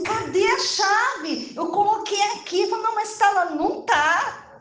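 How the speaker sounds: high, stretched-out demand for the key; acted quarrel. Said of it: "E começou aquela briga". (briga encenada, voz aguda e agitada)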